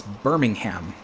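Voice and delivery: "Birmingham" slowed, ending in a full HAM; American pronunciation. Shown as a demonstration. Locals correct the visitor, "Birmingham" with a firm, clear "ham". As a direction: say "Birmingham" slowly as BUR-ming-HAM. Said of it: "this is America".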